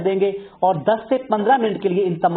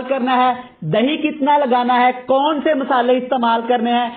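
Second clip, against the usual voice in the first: higher, lively channel host opening; upbeat show intro; 5 semitones higher than usual. Acted. Host welcoming viewers to his recipe channel, upbeat and higher. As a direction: greet viewers like a TV cook kicking off.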